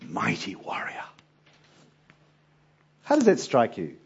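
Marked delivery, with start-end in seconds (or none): 0.1-1.0 whispered. Acted. Angel's greeting to Gideon.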